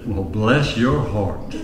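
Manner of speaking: slow, low, sweet Southern drawl; mock-gentle Southern reply to a bragging woman; gentle Southern lady. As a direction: ease it out slowly like a polite Southern lady. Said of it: "gentle, soft-spoken woman from the South".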